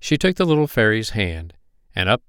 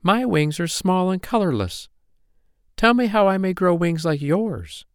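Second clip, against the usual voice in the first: high, wistful little-fairy voice; pleading cry; 10 semitones higher than usual. A narrator's reading. Poppy Pink begging Wonder Wings for advice, high plea. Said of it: "she cried".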